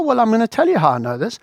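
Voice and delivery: normal talking voice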